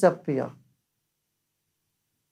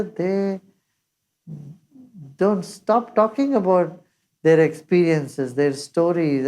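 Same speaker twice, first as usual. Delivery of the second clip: ordinary talk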